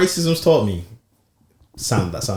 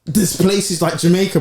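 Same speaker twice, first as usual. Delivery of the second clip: usual speaking voice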